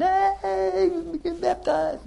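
high, bright mock call across the church; excited calling out.

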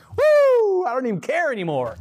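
high whoop of relief; joyful release. Acted. Believer freed from remembering the sin, whooping high with joy. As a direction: whoop out high, then rejoice with pitch up.

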